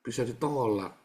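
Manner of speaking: usual speaking voice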